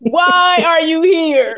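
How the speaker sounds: mock shout dragged out slowly. Mock kid yelling at lord.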